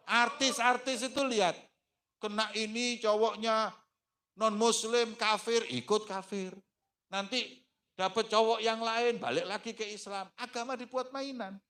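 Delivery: high, loud rebuking sermon; fiery preaching. Acted. Preacher rebuking those who trade faith for love, forceful. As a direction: scold the congregation with fire.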